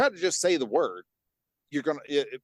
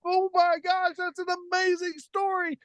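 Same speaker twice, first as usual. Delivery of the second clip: high mock-amazed gushing; squealed imitation of wowed audience; amazed fans. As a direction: gush in a very high voice, imitating astonished people. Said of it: "people are just like".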